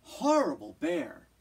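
loud, furious cry; angry shout. Acted. The girl stomping through the meadow, furious shout. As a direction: shout it in a temper. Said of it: "She stomped through the meadow".

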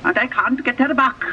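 put-on high, thin old woman's voice; old farm woman pleading. A narrator's reading. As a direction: voice the old lady high and worried.

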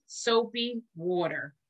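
ordinary delivery, nothing marked

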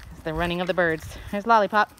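ordinary delivery

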